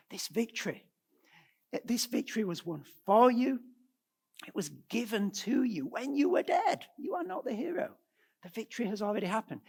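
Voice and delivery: high-pitched, emphatic insistence; insistent Paul. Acted. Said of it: "Paul is going out of his way".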